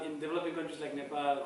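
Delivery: normal talking voice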